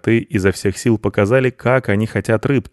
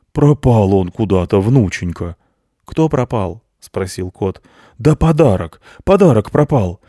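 put-on louder old man counting gifts; worried Grandfather Frost; 3 semitones higher, 4 dB louder than usual. Grandfather Frost searches the sack, louder fretting old voice. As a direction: fret over the missing present.

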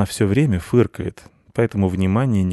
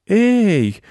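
indignant cry; 13 semitones higher, 6 dB louder than usual. A narrator's reading. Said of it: "возмутился мальчик".

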